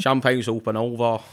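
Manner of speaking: put-on Mancunian accent. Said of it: "that was what I was channeling".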